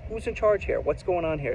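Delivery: real exasperated, high-pitched questioning. Real, not acted. Resident demanding who runs the neglected courts, exasperated.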